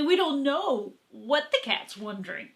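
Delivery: ordinary talk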